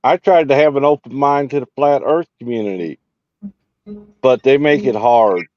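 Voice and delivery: ordinary delivery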